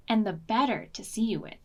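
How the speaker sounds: ordinary delivery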